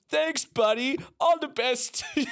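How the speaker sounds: laughing brush-off. Retold or imitated. Retelling how he waved off the headliner, laughing and high. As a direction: laugh while repeating the dismissive thanks. Mocking own breezy brush-off.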